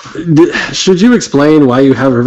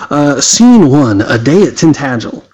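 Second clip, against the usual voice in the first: normal talking voice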